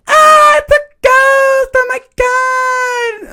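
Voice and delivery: very high, drawn-out shriek of fright; mock terrified scream. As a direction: shriek in hammed-up horror. Acting out panic at ghost.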